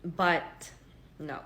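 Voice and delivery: usual speaking voice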